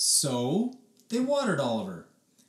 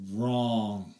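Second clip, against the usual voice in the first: ordinary delivery, nothing marked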